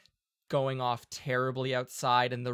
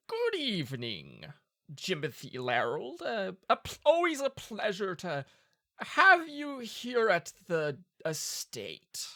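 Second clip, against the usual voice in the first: duke voice; 6 semitones higher than usual. Acted.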